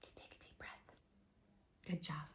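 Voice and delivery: soft, breathy guiding whisper. Shown as a demonstration. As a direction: hush it like coaching a child's breath.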